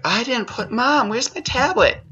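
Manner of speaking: put-on high-pitched whiny child asking mom; whiny kid. Child asking mom where the tablet went, high whiny voice. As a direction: whine like a kid who lost his tablet. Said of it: "They'll be like".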